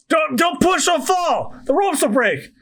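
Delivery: high-pitched acted panic; panicked warning. Dangling characters fear falling.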